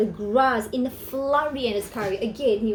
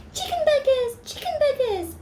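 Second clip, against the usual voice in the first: very high, bright chicken chant; chirping chickens; 13 semitones higher than usual. Acted. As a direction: peep it like little chicks. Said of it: "chirped the chickens".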